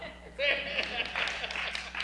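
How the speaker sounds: airy, voiceless laugh of disbelief; breathless laugh. Real, not acted. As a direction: let a stunned, breathy laugh slip out. Reacts to stranger's prophecy.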